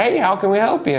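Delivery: a high, lightly voiced camper greeting. Camper greets the funeral worker at night, lightly voiced high. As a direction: pitch the greeting high as a stranger at the door.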